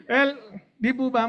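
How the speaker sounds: ordinary delivery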